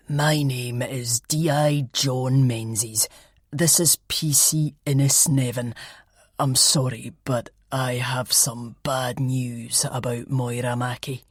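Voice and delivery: low, slow, sombre police voice; grave detective. Acted. Detective to the flatmates in the sitting room, low and sombre. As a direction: break the news gently but formally. Detective breaks bad news.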